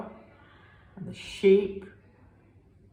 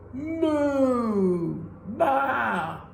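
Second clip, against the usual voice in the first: mooing and baaing; 6 semitones higher than usual. Acted. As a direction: moo and baa to scare the farmer. Animals cry under the bed.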